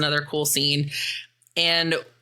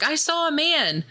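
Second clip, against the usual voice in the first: much higher, wide-eyed I saw a man; squeaky insistent claim; 11 semitones higher than usual. Retold or imitated. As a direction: play the spooked friend, voice jumping up. Sarah insists she saw someone.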